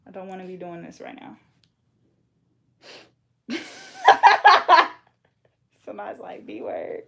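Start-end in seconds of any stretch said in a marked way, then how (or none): 3.5-5.0 high, loud burst of laughter; shrieking laugh. Real, not acted. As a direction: let out a big laugh. Reacting to the name.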